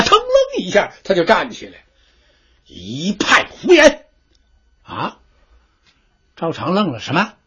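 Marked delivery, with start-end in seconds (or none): 2.7-5.2 slow, hammered-out outrage; furious general. Acted. Enraged general rejects the decree, slow furious outburst. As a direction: spit out each word in fury. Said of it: "可把这位元帅气坏了啊".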